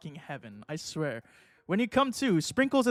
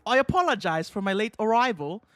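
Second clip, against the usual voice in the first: hammed-up British lecturer, slightly raised; British professor voice; 4 semitones higher, 4 dB louder than usual. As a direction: put on a bad British accent addressing students. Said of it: "British voice, and my British accent".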